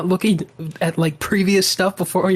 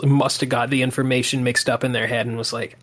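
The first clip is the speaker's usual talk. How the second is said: usual speaking voice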